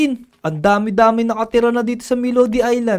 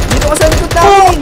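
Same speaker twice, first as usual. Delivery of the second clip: raised, higher-pitched call to a teammate; loud, pressing command; urgent shout; 4 semitones higher, 8 dB louder than usual. Acted. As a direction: shout it as a protective order. Urging friend away from zombies.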